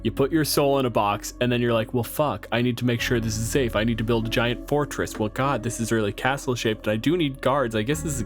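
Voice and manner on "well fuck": normal talking voice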